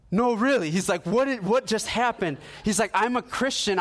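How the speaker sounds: imitating a stunned friend; wide-eyed retold disbelief; amazed insistence.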